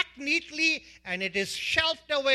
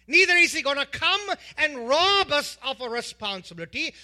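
building to a fervent peak; preaching; 5 semitones higher, 4 dB louder than usual. Acted. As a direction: preach it, rising in pitch.

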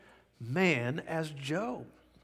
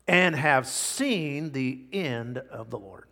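slow reading; slower than usual. Shown as a demonstration. Reading verse slowly.

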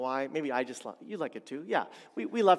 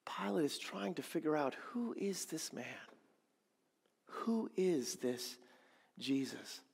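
hushed whisper; 8 dB quieter than usual. Real, not acted. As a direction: lean in and whisper the mystery. Hushed musing on Pilate's doubt.

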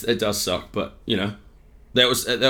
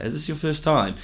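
put-on higher English-accented heckle; lightly voiced English woman's jab; mock English lady asking sweetly; English heckler; 7 semitones higher than usual. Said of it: "this woman's English voice".

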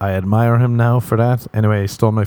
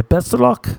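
much higher, bright sing-song; chirpy best of luck; 14 semitones higher than usual. Shown as a demonstration. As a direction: toss it off like a cheery farewell. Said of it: "That's what I like to say".

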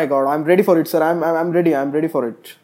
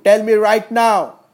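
angry demand; 7 dB louder, slower than usual. Real, not acted. Furious demand.

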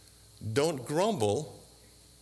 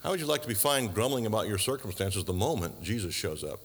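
ordinary talk, nothing marked

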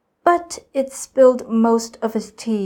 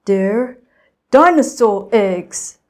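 proud reveal; 7 dB louder, slower than usual. A narrator's reading. Inventor reveals secret eggs.

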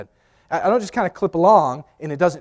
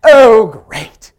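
louder, higher exasperated sarcasm; sarcastic dad; 6 semitones higher, 15 dB louder than usual. Retold or imitated. Father fed up at the roadside, sarcastic. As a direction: sigh it out like the day is ruined. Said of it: "as only my dad could do".

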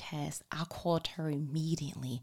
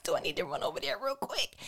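fast, high panicked question; 6 semitones higher, faster than usual. Retold or imitated. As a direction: rush it out in a mock panic. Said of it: "So I'm in panic mode".

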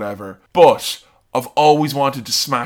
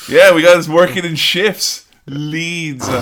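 sarcastic cop; 3 semitones higher, 5 dB louder, slower than usual. Retold or imitated. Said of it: "And the guy's like".

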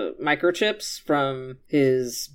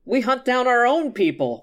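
high, put-on proud voice; mock boast; 9 semitones higher than usual. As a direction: crow it like a mock patriot.

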